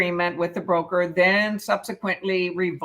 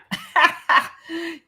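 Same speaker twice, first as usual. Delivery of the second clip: laugh; 10 semitones higher, 3 dB louder than usual. Real, not acted. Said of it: "Can't raise your hands on here".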